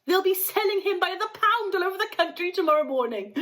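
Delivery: high, fast wailing; mother wailing over her son's fate; distraught wail. A narrator's reading. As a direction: lament in horror. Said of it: "screamed Missus Gloop".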